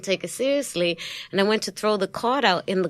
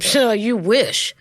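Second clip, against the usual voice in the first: sassy brush-off; 3 semitones higher, 5 dB louder, slower than usual. Retold or imitated. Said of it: "And I said".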